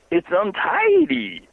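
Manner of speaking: high, slow, drawn-out Southern drawl on "It's untidy"; a hammed-up Southern old trooper, stretched out; Southern accent. Retelling the trooper's reply to the caller, in an imitated Southern accent. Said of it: "he had a Southern accent".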